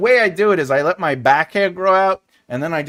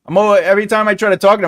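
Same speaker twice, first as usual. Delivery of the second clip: ordinary delivery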